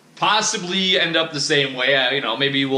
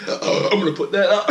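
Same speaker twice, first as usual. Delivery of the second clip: mock-stern vow, raised and strained; stern declaration; 7 semitones higher than usual. Vlogger quoting his own vow to release it, mock stern. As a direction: imitate your own firm promise, voice catching. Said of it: "I said something very, like, sternly".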